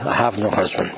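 low, dismissive brush-off; playing it down. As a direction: mumble it as if it's nothing. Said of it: "she plays it down".

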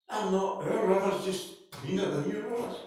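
army commander fuming at the prophet's order; indignant outburst. Acted. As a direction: bristle with wounded pride. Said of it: "he gets steaming".

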